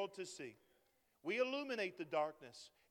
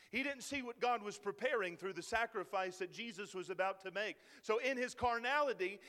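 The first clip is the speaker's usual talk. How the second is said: usual speaking voice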